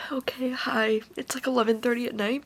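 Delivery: hushed whisper, barely voiced; late-night whisper. Real, not acted. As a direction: whisper softly so no one wakes. Late night after finishing book.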